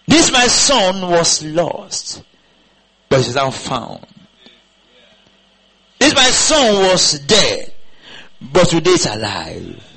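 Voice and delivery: slow, solemn proclaiming; preacher's solemn slow cadence; preaching. Acted. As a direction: lay the parable out slowly like scripture. Preaching the parable.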